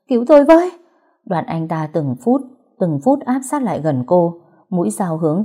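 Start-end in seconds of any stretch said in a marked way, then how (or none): 0.1-0.7 shrill, much louder scream for help; terrified scream. A narrator's reading. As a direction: scream it as the knife comes. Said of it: "mà hét lên".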